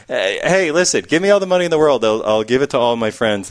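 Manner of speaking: ordinary talk, nothing marked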